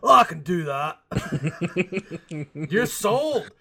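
high, laughing eager actor; laughing mock actor. Mocking Hollywood casting, laughing and high. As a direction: play the overeager actor, giggling. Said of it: "your boss in the movie industry".

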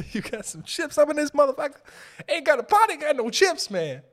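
high goofy whine asking for chips; hammed-up stoner. Comedian riffing as a stoner who wants chips, high whiny voice. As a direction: play a burnt-out guy hunting snacks.

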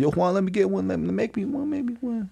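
whiny pleading imitation; imitating friends begging. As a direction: beg like a pestering buddy. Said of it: "Every, everybody's like".

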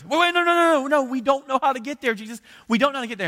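high, loud frantic interruption; acted-out desperate confusion at full voice; panicked protest. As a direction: blurt it out in alarm, pitch shot up. [name] protests in alarm, then calmed.

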